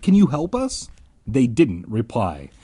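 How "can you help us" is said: high, urgent question; crying out. A narrator's reading. Lost narrator to the gray kids, crying out. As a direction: call out pleadingly for help. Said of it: "I cried".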